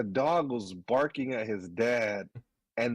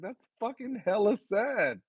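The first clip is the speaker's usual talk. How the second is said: mock sad reaction; 11 semitones higher than usual. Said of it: "I was like".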